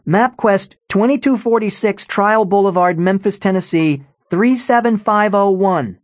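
high-pitched call across the house; shouting. A narrator's reading. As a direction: shout out the address, pitched well up. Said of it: "Hassan shouted".

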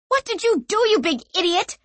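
put-on high, sharp yelling girl; angry young woman. A narrator's reading. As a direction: shout it at him, exasperated. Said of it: "she cried at him".

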